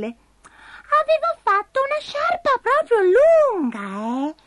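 very high cartoon creature explaining herself; tiny squeaky voice telling a mishap; falsetto, stretching out the excuses; Abigail the little spider, sheepish. A narrator's reading.

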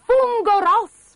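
high, loud exclamation; acted shout of discovery; excited cry. A narrator's reading. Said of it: "gridò Bastoncello".

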